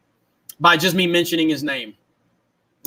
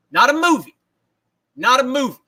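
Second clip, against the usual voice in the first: Allen Iverson rant; 5 semitones higher than usual. Retold or imitated. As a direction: bark it like the famous press conference. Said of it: "the new Allen Iverson".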